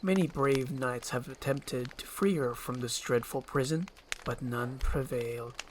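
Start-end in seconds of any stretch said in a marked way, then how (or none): none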